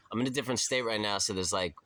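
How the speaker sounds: ordinary talk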